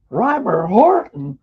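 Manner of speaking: high, slow, amazed repeat of the name; astonished echo. Retold or imitated. Repeating the famous name back to the grandson in disbelief. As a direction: say the name like you can't believe it.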